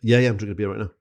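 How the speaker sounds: usual speaking voice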